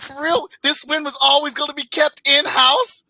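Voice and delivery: high disbelieving question; incredulous. Retold or imitated. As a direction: ask it like you can't believe it. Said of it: "I was like".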